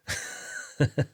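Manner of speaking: a quick airy laugh; amused airy chuckle; breathy laugh. Real, not acted. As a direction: let a short laugh slip out. Laughing at a time-loop theory.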